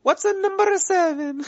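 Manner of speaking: put-on high old Italian lady voice. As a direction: ask like a confused nonna. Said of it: "You said you weren't gonna do it".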